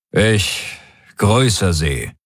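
very slow and dry, unbowed; dry greeting. A narrator's reading. Warlord greeting the alien probe, dry and cold. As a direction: greet coolly, hand still on the weapon. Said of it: "sagte Daraios trocken".

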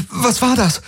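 very high, startled question; startled alarm. A narrator's reading. Kirai startled as the ship is hit, alarmed cry.

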